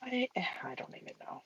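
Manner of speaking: whispered, defeated; whispering. Real, not acted. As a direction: whisper it under your breath. Frustrated painter answers friend.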